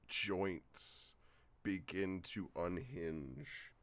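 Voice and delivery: slow, drawn-out scene narration; story narration. Acted. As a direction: set the scene slowly and grandly. GM scene setting.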